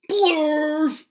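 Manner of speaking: loud goofy blurt; silly shout. Acted. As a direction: belt out the nonsense word. Silly nonsense word shouted.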